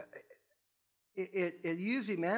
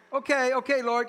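exasperated giving in; 6 semitones higher, 6 dB louder than usual. Retold or imitated. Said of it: "it's like".

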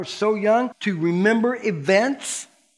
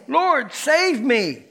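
pleading cry; 7 semitones higher, much slower than usual. A narrator's reading. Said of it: "he cried out".

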